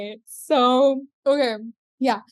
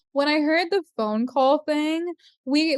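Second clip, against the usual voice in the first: usual speaking voice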